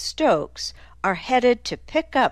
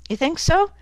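high-pitched mocking question; sarcastic challenge; 10 semitones higher than usual. A narrator's reading. Nick answering Dozer's harassment claim, sarcastic. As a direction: throw the complaint back at him.

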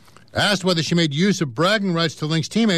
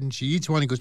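normal talking voice

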